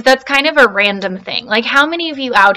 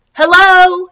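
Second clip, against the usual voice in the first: loud, high call across water; 9 semitones higher, 8 dB louder than usual. Acted. Little Beaver calls across the pond, loud and high. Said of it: "he called".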